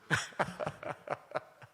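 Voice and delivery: hearty laugh after the punchline; laughter. Real, not acted. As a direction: laugh at your own story. Laughs after retold exchange. (이야기 끝에 터지는 웃음)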